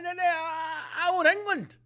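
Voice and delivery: mock sobbing protest. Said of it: "You'd be like".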